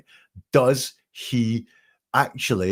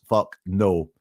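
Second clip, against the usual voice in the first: ordinary talk, nothing marked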